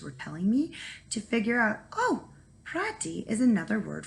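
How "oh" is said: plain reading voice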